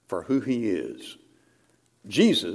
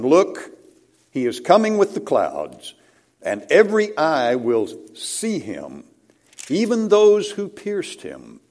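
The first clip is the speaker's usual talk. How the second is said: proclaiming scripture; 3 semitones higher, 5 dB louder than usual. Acted. Reads Revelation with force.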